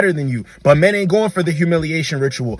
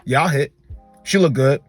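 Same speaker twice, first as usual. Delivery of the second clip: lower, casual bro voice; blunt guy talk; 5 semitones lower than usual. Retold or imitated. A man imitating how guys talk about women, lightly voiced low bro. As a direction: toss it off like locker-room banter. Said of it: "Men, we don't talk like that".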